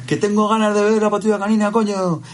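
put-on high child's voice, cursing; squeaky toddler swearing casually; small kid saying coño; imitating his daughter. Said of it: "daughter swearing again".

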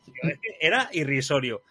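ordinary talk

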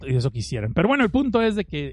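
usual speaking voice

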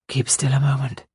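quick breathless whisper; whispered urgent plea to lie still. A narrator's reading. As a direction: hurry it out under the breath. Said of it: "he said quickly".

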